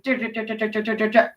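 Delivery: soft repeated dur like rattling chains; quiet mock clank of chains; chain door sound. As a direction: mimic a drawbridge clanking. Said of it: "sound like chains going".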